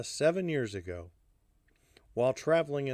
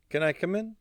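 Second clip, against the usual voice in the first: mildly anxious, higher-pitched request; fretted; 8 semitones higher than usual. A narrator's reading. Alla asks to enter Hardy's apartment, mildly fretted. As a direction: ask with a touch of fretfulness. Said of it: "Fretted, she asked".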